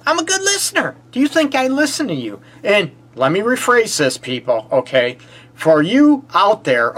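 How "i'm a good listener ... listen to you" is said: high, earnest question to a partner; a lightly voiced partner asking if they listen; asking your partner. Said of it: "ask your partner".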